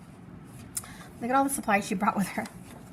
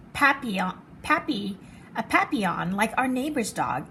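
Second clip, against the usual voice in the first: usual speaking voice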